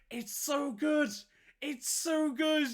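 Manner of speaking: high, slow, drawn-out delight; gush, stretching each word up high; excited gushing. Real, not acted. Host raving about flamethrower blunderbusses, high excited gushing.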